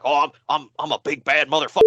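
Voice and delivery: lightly voiced puffed-up braggart, fast; mock tough guy. Mocking Mister Anderson's swagger for his cohost, a light mock tough guy. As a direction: mimic a swaggering blowhard, half-hearted. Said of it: "made himself all puffed up".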